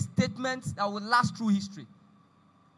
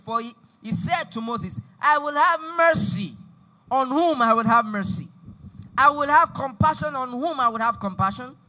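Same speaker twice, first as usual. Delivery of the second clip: forceful, raised-voice Bible reading; scripture read aloud; 3 semitones higher, 5 dB louder than usual. Acted. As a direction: declaim the passage, driving the key words. Reads scripture aloud to congregation.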